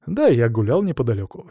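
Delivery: usual speaking voice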